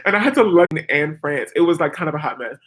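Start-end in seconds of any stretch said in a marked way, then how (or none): none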